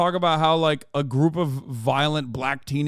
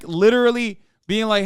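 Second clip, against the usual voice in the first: normal talking voice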